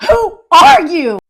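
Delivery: loud, slow tearful demand; mock distress. Confused wife facing her husband, loud mock-tearful distress. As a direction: drag it out in hammed-up panic. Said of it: "I am so upset for her".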